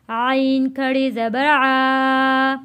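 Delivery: "आ" drawn out one alif long; stretched vowel. Shown as a demonstration. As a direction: slowly stretch the final "आ". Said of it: "खींच कर पढ़ेंगे". ("आ" को एक अलिफ के बराबर खींचकर)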